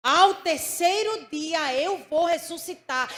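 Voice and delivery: loud, slow, declaiming; preacher repeating Jesus's promise as a loud proclamation; proclaimed loud. Acted. Same quote repeated, proclaimed.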